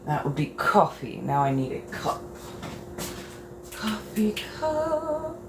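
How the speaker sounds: ordinary delivery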